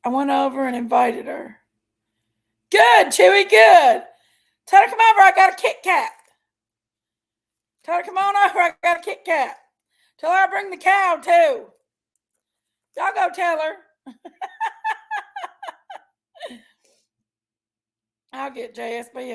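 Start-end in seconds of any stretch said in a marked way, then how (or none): 2.7-9.0 high, loud call to the dog. Real, not acted. Calling out to the dog. 14.1-16.0 high-pitched giggle; high squealing laugh. Real, not acted.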